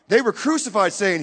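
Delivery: usual speaking voice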